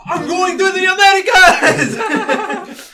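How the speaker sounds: excited fan shout. Retold or imitated. Friend imitating their Belgian fan, mock shout. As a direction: yell it like a thrilled tourist. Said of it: "he's probably gonna be listening".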